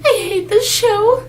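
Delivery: high, slow tearful wail; hammed-up sobbing whine, breaking into a laugh; mock crying. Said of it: "and I'm crying".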